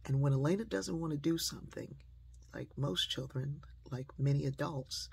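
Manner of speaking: ordinary delivery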